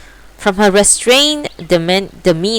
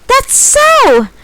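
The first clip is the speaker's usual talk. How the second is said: very high exclaiming cat; triumphant; 4 dB louder than usual. Acted. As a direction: crow it out.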